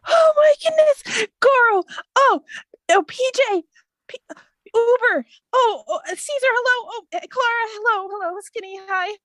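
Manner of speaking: high, loud squeals of recognition; overjoyed greeting. Acted. Hava greets long-lost friends one by one, squealing with joy. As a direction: gush each name, breaking off in delight.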